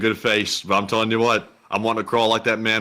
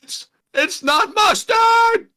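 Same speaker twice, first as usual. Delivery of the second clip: very high, loud, slow comic shriek; Jerry Seinfeld; 5 dB louder, much slower than usual. Acted. Doing the comedian's voice on the co-host's request, shrieking high. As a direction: shout it in an exaggerated falsetto whine. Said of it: "Do the Jerry Seinfeld voice".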